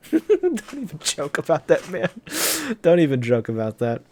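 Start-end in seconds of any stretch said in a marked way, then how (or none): 0.1-2.1 laughing through the words, high; real amused protest. Real, not acted. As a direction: chuckle while you say it. Reader reacts laughing.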